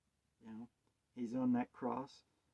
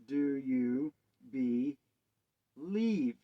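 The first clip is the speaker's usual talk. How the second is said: slow drawn question; much slower than usual. Acted.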